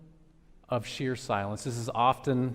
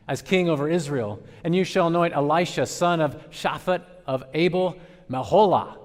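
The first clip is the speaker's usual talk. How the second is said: commanding proclamation; 3 semitones higher, 5 dB louder than usual. Acted. Preacher reading God's orders to Elijah: loud proclamation.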